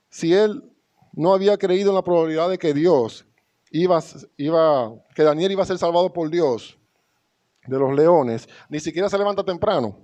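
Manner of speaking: preaching with force. Acted. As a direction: press the point to the congregation. Predicación enfática. (predicación enérgica)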